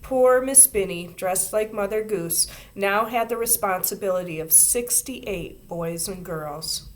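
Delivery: plain reading voice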